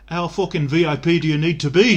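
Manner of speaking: a hint of a bad Liverpudlian accent, indignant. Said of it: "terrible Liverpudlian accent".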